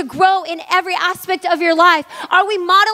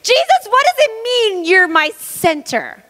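retold plea to God; 5 semitones higher than usual. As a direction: imitate bewildered questioning of God, starting high and stretching the words. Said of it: "I'm having this conversation with God".

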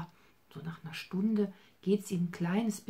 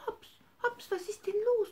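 high, bright call to the bunny. Acted. Grandma calls out to little Hops, high calling voice. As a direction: call him out of his bed.

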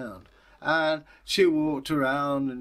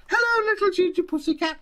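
put-on very high, quick girlish greeting; little girl; 5 dB louder, faster than usual. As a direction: coo at the cat like a child.

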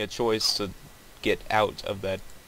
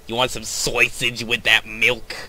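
hammed-up Jersey deli guy; Jersey accent. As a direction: play a brash Jersey guy. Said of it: "my Jersey accent".

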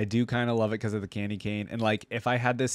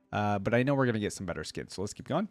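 ordinary talk

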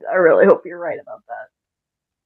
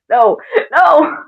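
high, loud cry of alarm; frightened outburst; panicked refusal; 6 semitones higher, 6 dB louder than usual. Acted. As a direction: protest in rising panic. Panics when pointed at.

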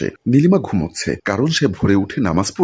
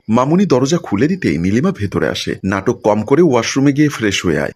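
plain reading voice

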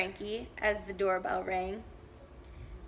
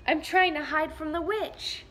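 shaky scared knight; 5 semitones higher, 3 dB louder than usual. Acted.

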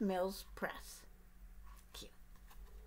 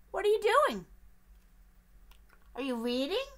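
high, loud, pushy little duck; eager duck; 8 semitones higher, 6 dB louder, faster than usual. Acted. Duck pestering the bear with questions, high and loud. Duck's voice in story.

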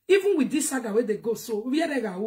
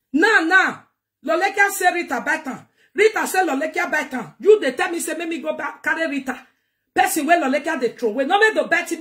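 loud, raised, insistent; heated rant; 5 semitones higher, 6 dB louder than usual. Real, not acted. Scolding viewers over the quarrel between Rita and Lolekia, loudly. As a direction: hammer it at the viewers.

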